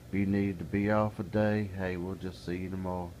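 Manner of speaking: ordinary talk, nothing marked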